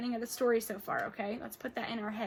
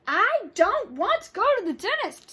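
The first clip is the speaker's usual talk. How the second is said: higher, louder stubborn whine; much higher and louder sulky refusal; whiny refusal; 10 semitones higher, 10 dB louder than usual. Acted. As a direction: dig in, whining no. Child refusing dentist.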